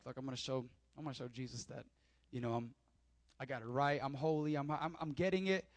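a touch of a self-satisfied Peter, low and soft; smug Peter. Retold or imitated. Peter psyching himself up before Jesus, quietly smug. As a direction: mutter it to yourself like you've got it right. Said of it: "he's actually saying it like".

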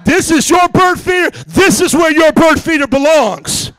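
loud, high, emphatic telling-off; retold street-tough shouting. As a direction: lay down the law to the neighbor.

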